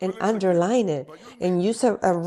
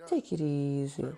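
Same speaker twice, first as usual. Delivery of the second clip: soothing wife; 7 semitones lower, 7 dB quieter than usual. Acted. Preacher voicing a wife calming her man, soothing.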